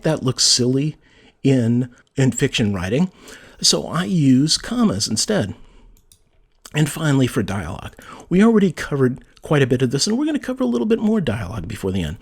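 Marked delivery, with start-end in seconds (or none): none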